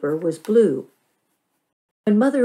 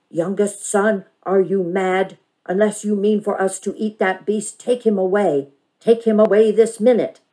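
a hint of a stern, cold grandfather; mock his icy order; 3 semitones higher, 6 dB louder than usual. Bandit mimics Grandfather ordering the dog away, a hint of cold. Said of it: "His voice was as cold as".

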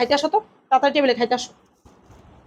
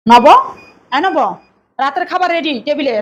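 calling out; 2 semitones higher, 6 dB louder than usual. Acted. Wife calls husband to dinner.

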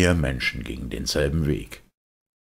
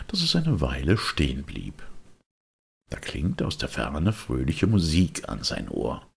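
ordinary delivery, nothing marked

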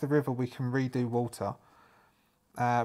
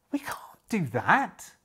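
objecting whisper; 5 semitones higher than usual. Acted. Character objects to robbing.

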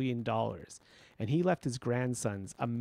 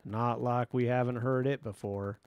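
put-on Texas accent. A narrator's reading. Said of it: "had the thickest Texas accent".

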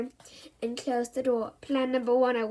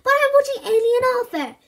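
high, loud whiny protest; whiny boy; 11 semitones higher, 9 dB louder than usual. Acted. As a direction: whine like a kid. Bertie protests.

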